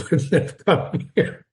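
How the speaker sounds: breaking into laughter mid-line; words dissolving into giggles; laughing. Real, not acted. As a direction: try to read on through a real laugh. Breaks up laughing while reading aloud.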